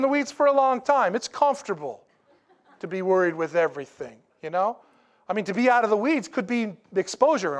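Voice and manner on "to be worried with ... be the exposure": ordinary delivery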